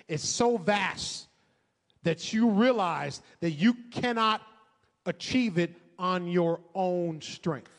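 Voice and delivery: loud preaching build; fervent preaching. Acted. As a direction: preach it with rising fire. Preaching builds to full force.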